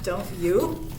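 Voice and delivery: usual speaking voice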